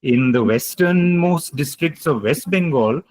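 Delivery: usual speaking voice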